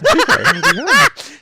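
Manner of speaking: loud, high pixie giggle; shrill mischievous giggle. Acted. Pixie laughs after the reveal.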